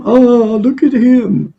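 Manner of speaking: a hint of an amused crowd voice; mocking gawker. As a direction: tease it like a zoo visitor pointing and laughing. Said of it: "they're all laughing at 'em".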